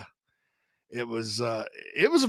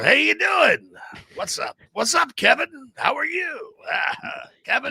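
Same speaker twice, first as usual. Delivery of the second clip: Al Pacino impression; 10 semitones higher than usual. Host imitating Pacino greeting Kevin, hammed up. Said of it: "But Al Pacino".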